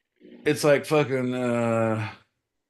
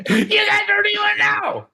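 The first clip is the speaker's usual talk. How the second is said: much higher, louder yelled boast; mock shout; 4 dB louder than usual. Boasting to the listeners, shouted. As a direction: yell it to the crowd.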